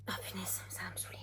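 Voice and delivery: chuchotement agacé pour soi; chuchoté. Real, not acted. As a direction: marmonner son agacement dans un souffle. Agacée, cherche un mot en vain. (chuchotement agacé)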